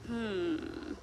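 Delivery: usual speaking voice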